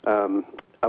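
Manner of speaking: ordinary talk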